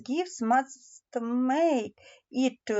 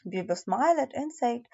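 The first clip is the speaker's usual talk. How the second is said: usual speaking voice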